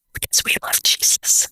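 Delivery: hushed whisper, almost no voice; whispered answer. Retold or imitated. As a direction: whisper it like a gentle secret. Retelling a tender answer in office.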